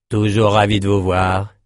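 polite words, doubtful tone; insincere politeness. Acted. Doorman greeting Amos, a hint of insincere politeness. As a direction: say the welcome without meaning it. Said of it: "démentis par le ton du portier".

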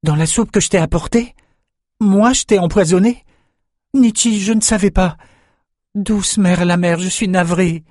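high, pleading, horrified apology; distraught. A narrator's reading. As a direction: shaken young man begging forgiveness. Young man horrified at his mistake.

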